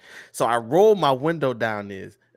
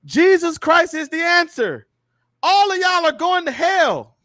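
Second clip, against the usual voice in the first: high, loud, slow hellfire proclamation; megaphone street preacher; 6 dB louder, slower than usual. Retold or imitated. Street preacher yelling at fans outside the stadium, loud mock proclamation. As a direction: imitate a preacher hollering drawn-out warnings over a crowd. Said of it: "a megaphone, whatever he had".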